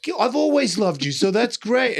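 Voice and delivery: sarcastic mock reply. People he hurt mocking his apology, high sarcastic voice. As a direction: mimic the scorned ex rolling their eyes.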